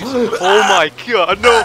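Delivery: high, loud, drawn-out scream; shriek. Real, not acted. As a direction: shriek at the near goal. Near miss in game.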